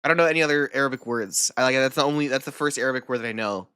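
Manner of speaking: ordinary talk, nothing marked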